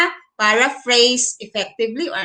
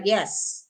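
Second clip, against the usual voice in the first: soft, low "yes" falling at the end; "yes" dropped low, unconvinced; falling intonation; 4 semitones lower, 8 dB quieter than usual. Shown as a demonstration. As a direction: let "yes" sink in pitch. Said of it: "when if it's falling".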